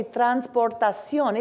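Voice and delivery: "transportación" with Spanish vowels and final stress; Spanish pronunciation. Shown as a demonstration. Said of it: "The same concept translates to Spanish".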